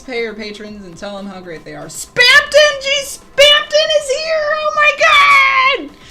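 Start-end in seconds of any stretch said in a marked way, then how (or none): 1.9-6.0 shrieking, very high and loud with delight; excited squeal. Real, not acted. Streamer greeting a chat raid, squealing with excitement. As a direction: squeal the name at the raiders arriving.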